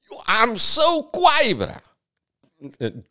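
high-pitched mock brag; mock boasting. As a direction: boast in a put-on high voice, drawn out. Mocking [name]'s self-importance.

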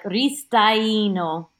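"Ristaino" drawn out, a and i sounded separately; Italian "Ristaino" with both middle vowels voiced; Italian pronunciation. Shown as a demonstration. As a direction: slowly demonstrate "Ristaino" as Ree-sta-EE-no. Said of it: "often they'll pronounce them both".

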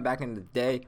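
ordinary talk, nothing marked

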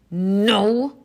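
loud, grumpy refusal; storybook grouch digging in; grumbled. A narrator's reading. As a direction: grumble it out like a cross little panda. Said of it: "grumbled Pom Pom".

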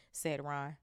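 plain reading voice

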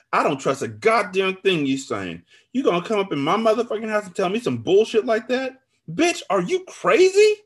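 rising, high-pitched angry rebuke; outraged. A narrator's reading. Friend rebukes narrator in her house, outraged. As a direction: build to a loud, stretched incredulous question.

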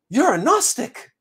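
high, startled outburst, mimicking her; surprised exclamation. Woman recognizes altar, exclaims.

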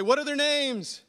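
loud, high, slow shout; calling out. Pretend. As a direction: call it out big across the street. Calling out across the street.

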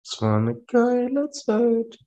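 high, slow silly parrot voice; mocking high voice. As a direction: stretch it out in a goofy squeak. Friend parrots in funny voice.

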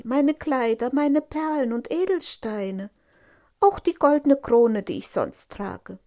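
high, loud princess voice listing her treasures; eager princess. Acted. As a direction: offer everything, loud and high-pitched. (eifrige Prinzessin, hoch und laut)

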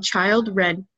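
put-on "Children" said as "child" plus "red"; spelling pronunciation. Shown as a demonstration. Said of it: "That's how it's spelled".

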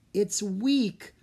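building from calm to high and loud; rising excitement. A narrator's reading. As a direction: let the thrill climb as the link grows. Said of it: "his voice rising with excitement".

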